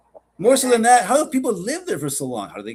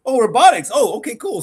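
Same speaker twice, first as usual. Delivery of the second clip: sarcastic mock approval; 5 semitones higher, 7 dB louder than usual. Mocking the official explanation.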